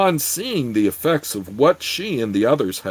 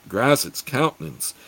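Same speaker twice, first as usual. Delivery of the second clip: ordinary delivery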